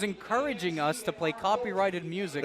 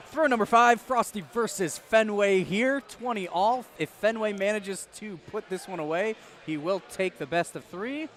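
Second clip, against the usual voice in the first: announcer calling the throw; play-by-play; 4 semitones higher than usual. Acted. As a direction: call it like live sports commentary.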